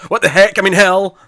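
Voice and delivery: loud, high mock outburst; flustered self-correction. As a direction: blurt it out, then hastily correct yourself. Imitating Tilly's flustered self-correction.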